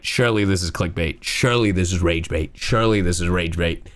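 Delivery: low, muted deadpan; deadpan disbelief. Retold or imitated. Streamer recalling reaction to the clip, deadpan disbelief.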